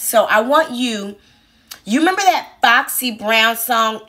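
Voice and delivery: ordinary talk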